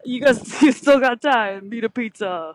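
laughing, hammed-up bro toast; rowdy best man. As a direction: shout the toast through laughter. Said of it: "I said some stuff about".

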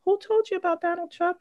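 high, surprised question; astonished question. Retold or imitated. To her little son, a high astonished question. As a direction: rise high as if caught off guard. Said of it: "And I was like".

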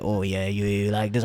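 low, soft seductive murmur. Retold or imitated. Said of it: "I'm looking at her seductively".

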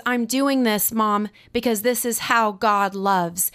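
ordinary talk

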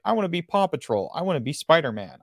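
a hint of a child's voice; kid picking a costume. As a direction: quote an eager kid's costume wish. Voices kids choosing costumes.

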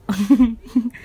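short awkward giggle; embarrassed laugh. Real, not acted. Laughs at own word choice.

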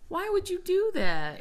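high, exasperated scolding question. Real, not acted. Scolding over the touched microphone.

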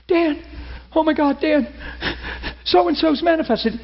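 high, urgent, breathless news; panicked caller. Retold or imitated. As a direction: friend calling in alarm. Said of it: "panicked caller".

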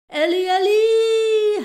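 high, drawn-out loud howl; big whooping holler, stretched out; howled call. A narrator's reading. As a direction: howl the name out long and high. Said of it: "howled Buck".

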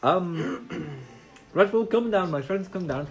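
put-on Italian-accented ringmaster. Plays the ringmaster.

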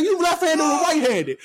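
high, loud mock mother scolding; hammed-up angry mom voice; imitated scolding mom. Mom questioning.

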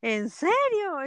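drawn-out, slow, mock-amazed question; a stretched-out "really?"; slow disbelieving echo of Venezuelans; imitated surprise. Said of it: "me dicen".

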